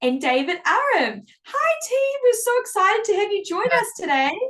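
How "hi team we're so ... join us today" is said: excited greeting. Real, not acted. Greeting guest team live.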